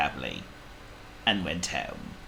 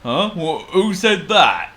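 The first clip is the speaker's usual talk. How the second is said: Trumpet; 10 semitones higher, 9 dB louder than usual. A narrator's reading. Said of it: "said Trumpet".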